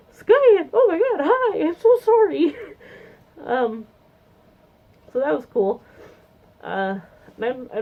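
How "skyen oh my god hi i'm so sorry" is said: much higher and much louder, gushing apology; excited surprised greeting. Retold or imitated. Spotting the friend she walked past, a loud high squeal. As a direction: squeal his name, then gush sorry.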